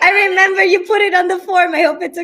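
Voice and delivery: fast, laughing words. Real, not acted. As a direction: rush it out through a laugh. Still laughing, rushing on.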